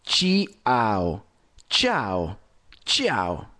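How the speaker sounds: ciao said with a crisp ch sound, chao; ciao pronounced chao, not chiao; Italian ciao. Shown as a demonstration. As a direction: say ciao as chao, one syllable. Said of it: "Y no es chiao".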